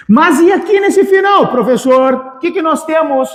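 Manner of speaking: high-pitched imitation of a puzzled student; student's question. Teacher voicing a student who asks about the ending, high-pitched questioning. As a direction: pipe up as a pupil querying the teacher.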